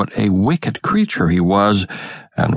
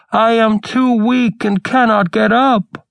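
put-on old grandmother; 13 semitones higher than usual. A narrator's reading. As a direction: call out weakly from a sickbed. Grandmother calls from bed.

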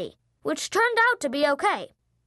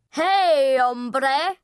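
louder, hammed-up Mexican accent; terrible Mexican accent; 4 dB louder than usual. A narrator's reading. Dad voicing stuffed gecko, terrible Mexican accent. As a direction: greet him in a bad Mexican accent. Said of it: "in the worst Mexican accent ever".

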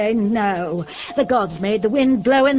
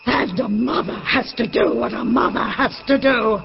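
ordinary talk

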